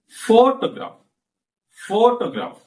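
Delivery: "Photograph" with PHO high and long; stress on first syllable. Shown as a demonstration. Teacher repeating demo for class, strong first-syllable stress. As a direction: hit PHO in "Photograph", then drop. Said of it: "I repeat. Listen".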